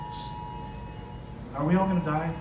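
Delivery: ordinary delivery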